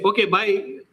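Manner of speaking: high-pitched mock dismissal; breezy brush-off. Listener escaping as soon as I start speaking, mock brush-off. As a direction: cheerfully cut them off mid-boast, mimicking. Mimics listener cutting off a self-talker.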